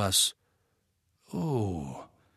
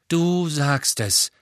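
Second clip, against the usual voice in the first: slow, louder eager agreement; enthusiastic agreement; 4 semitones higher, 4 dB louder, slower than usual. A narrator's reading. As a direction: agree with gusto. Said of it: "bestätigten Ron und Harry begeistert". (begeistert zugestimmt)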